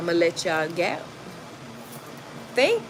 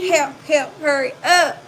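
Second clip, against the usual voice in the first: higher, louder frantic call; mock cry for help; 7 semitones higher, 5 dB louder than usual. The man on the phone calling police, high mock panic. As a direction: squeal for help like him. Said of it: "And I heard".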